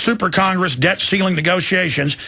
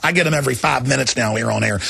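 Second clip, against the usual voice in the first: normal talking voice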